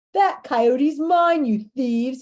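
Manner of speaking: loud, slow angry snarl. A narrator's reading. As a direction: snarl it at the thieves. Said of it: "he snarls".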